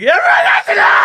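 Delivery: high, fast, hammed-up shout; mock town crier. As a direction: yell the news to the whole street. Mock shouting the news to everyone.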